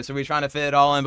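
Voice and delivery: ordinary talk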